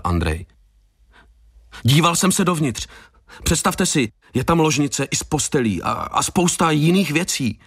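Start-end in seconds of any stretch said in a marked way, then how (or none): 1.8-7.6 high, excited, stumbling delivery; breathless joy. A narrator's reading. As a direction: stammer with delight in a raised pitch. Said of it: "Zajíkal se radostí". (zajíkavě radostným, vyšším hlasem)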